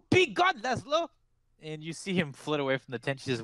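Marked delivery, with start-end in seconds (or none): 0.1-1.1 high shrill cry of dismissal; sharp command. Acted. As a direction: shoo him out with a shriek. Seer shoos away a servant.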